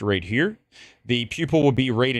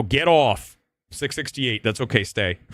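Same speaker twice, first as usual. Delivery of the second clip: ordinary delivery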